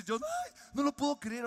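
high, loud delighted disbelief; amazed. Retold or imitated. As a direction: burst out in wonder. John amazed.

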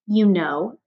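plain reading voice

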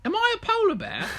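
squeaky, very high English cub voice; English baby polar bear. Acted. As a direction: ask in a tiny English bear-cub falsetto. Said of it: "I don't know why he's English".

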